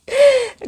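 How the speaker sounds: a loud burst of high laughter. Real, not acted. Mother laughing to viewers at son's cheeky reply.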